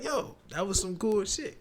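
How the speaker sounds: high, thrilled gush; excited. Retold or imitated. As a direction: sound delighted with the toy. Recalling the new computer.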